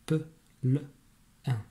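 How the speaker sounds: "Pe, le, un" said one by one with pauses; sounds split apart. Shown as a demonstration. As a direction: separate each sound in "plein". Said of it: "j'entends trois sons dans plein".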